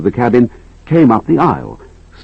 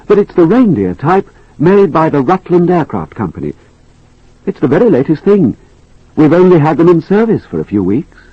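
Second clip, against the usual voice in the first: higher, polite air hostess; bright helpful stewardess, lifted pitch; 6 semitones higher than usual. Acted. Stewardess explains aircraft.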